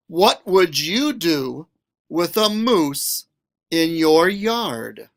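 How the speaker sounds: usual speaking voice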